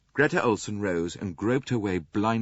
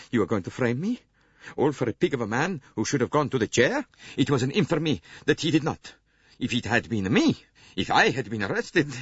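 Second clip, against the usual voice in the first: louder, higher, indignant Italian; heated denial, a touch louder; indignant outburst; 6 semitones higher, 5 dB louder than usual. Acted. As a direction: protest hotly against the accusation. Suspect protesting, indignant.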